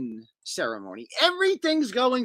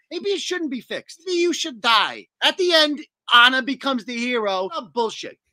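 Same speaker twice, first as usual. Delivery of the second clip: ordinary delivery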